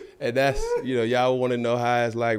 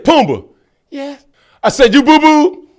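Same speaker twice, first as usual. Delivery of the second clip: stern dad; 13 semitones higher, 14 dB louder than usual. Retold or imitated. Dad questioning son, calling.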